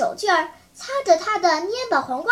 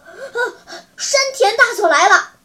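alarmed loud announcement; 5 semitones higher, 4 dB louder than usual. A narrator's reading. Guard runs in announcing the colonel's arrival, loud and alarmed. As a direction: burst in louder, breathless with alarm. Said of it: "大声说".

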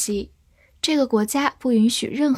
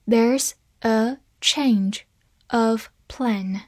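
very slow, each word apart; slow reading; much slower than usual. Shown as a demonstration. Teacher reads once slowly for learners, slow reading. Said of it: "好，慢读一遍".

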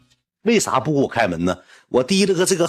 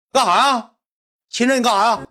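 high-pitched mock protest; startled protest; 9 semitones higher than usual. Friend whose glasses got yanked off, startled protest. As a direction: imitate a friend caught off guard, voice up.

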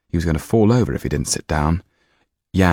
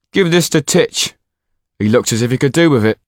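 raised, brisk tavern man's voice; cheerful servant offering wine, higher and louder; bald man's voice; 8 semitones higher, 6 dB louder than usual. Acted. Bald servant hands Jan wine for Titch, hearty higher voice.

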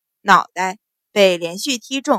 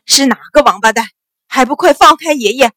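loud, furious, pained swearing; angry yell from someone in pain; loud cursing; 2 semitones higher, 8 dB louder than usual. A narrator's reading. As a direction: curse and demand to be let go. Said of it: "大声骂道".

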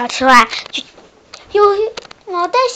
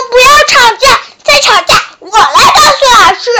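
high, loud telling-off; angry scolding; 9 semitones higher, 6 dB louder than usual. Acted. Duck scolding frog and shark to stop quarrelling. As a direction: scold them both sharply. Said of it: "小鸭子非常生气".

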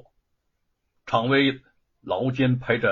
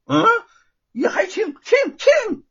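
very high, squealed dissatisfaction; Monkey King; 7 dB louder than usual. A narrator's reading. Monkey King still finding the halberd light, high complaint. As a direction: climb higher and louder on each repeat.

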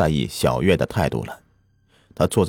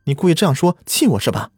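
higher, fast, furious accusing; angry outcry; 10 semitones higher, faster than usual. A narrator's reading. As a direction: hurl the blame at him. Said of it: "小慧叫道".

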